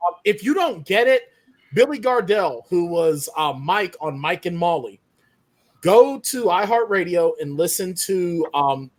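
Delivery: ordinary talk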